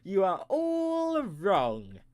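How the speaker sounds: very slow, drawn-out pronouncement; hammed-up slow reveal to the audience; dramatic verdict. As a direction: stretch every word like a game-show reveal. Mock-dramatic verdict on audience guesses.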